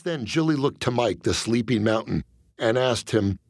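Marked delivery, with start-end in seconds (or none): none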